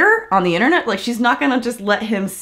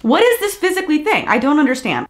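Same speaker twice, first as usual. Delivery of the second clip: baffled outburst; 8 semitones higher than usual. Real, not acted. Vlogger baffled by the dad's wording, incredulous outburst. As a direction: throw the question up in disbelief.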